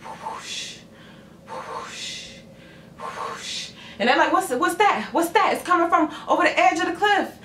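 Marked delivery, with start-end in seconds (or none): 0.0-3.7 soft, airy whoosh noise; hushed rushing-wind effect, spaced out; imitated whoosh. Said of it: "they start hearing this sound". 4.3-7.4 high, hurried mock alarm; puzzled crowd. Crowd puzzled by a strange sound.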